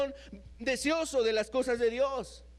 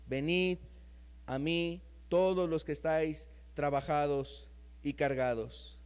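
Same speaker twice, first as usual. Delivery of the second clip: lower, softer pastoral invitation; tender, hushed sermon voice; gentle preaching; 8 semitones lower, 4 dB quieter than usual. Acted. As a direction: draw the tired listener in gently. Soft, low invitation passage.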